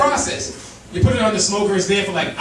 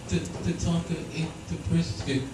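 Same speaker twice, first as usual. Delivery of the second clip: put-on soft, hushed child's report, a touch of worry; a small voice breaking bad news; hushed little daughter; 6 semitones lower, 9 dB quieter than usual. Daughter quietly telling dad the dog ate it, hushed. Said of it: "My daughter goes".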